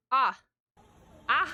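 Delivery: normal talking voice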